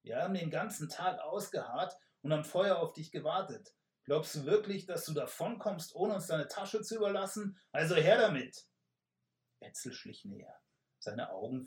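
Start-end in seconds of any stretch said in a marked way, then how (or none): 0.0-8.7 high, loud, menacing robber; bully the rider for his bag; rough robber voice. Acted. Robber demands the messenger's bag, loud and threatening. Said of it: "eine zweite, raue Stimme".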